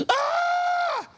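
sudden high yelp of disgust; shocked outcry. Retold or imitated. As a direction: yelp it out like the thought is revolting. Abscheu der Juden vor Schweinen.

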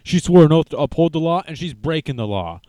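hammed-up indignant crowd voice; mock outraged crowd. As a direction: parody people who cheer the opposite case. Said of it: "and they're like".

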